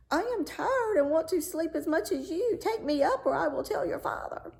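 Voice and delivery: high, whiny frog threatening; a sly frog nagging to be taken up. Acted. Frog at the bedside threatening the princess, high and whiny.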